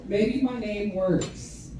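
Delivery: slow, soft afterthought; quiet. Shown as a demonstration. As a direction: say it softly and slowly to yourself. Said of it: "So maybe my name works".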